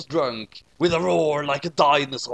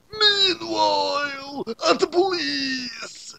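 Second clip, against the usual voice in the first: high, very slow, wailing announcement; dramatic scene caller; 12 semitones higher, much slower than usual. Acted. Narrator switching the scene to the police, high and slow. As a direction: wail it like a cartoon transition.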